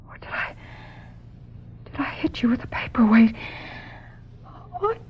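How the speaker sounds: breathless whisper to herself; horrified whisper. Acted. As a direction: whisper the dawning guilt. Realizing she may have killed.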